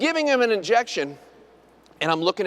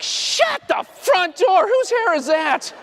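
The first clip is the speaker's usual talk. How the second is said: shocked squeal; 12 semitones higher, much slower than usual. Retold or imitated. Dentist shrieking at patient's hairpiece in disbelief. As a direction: gasp it out in total shock.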